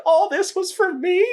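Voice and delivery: very high, loud put-on girl voice; hammed-up high-pitched imitation; mock-touched squeal. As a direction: squeal it in exaggerated disbelief. Woman's reaction in retold sketch.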